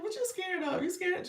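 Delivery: put-on high, loud playful teasing of a scared child; high-pitched amused mom voice. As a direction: tease him, voice up high and bright with amusement. Mom teasing her scared son.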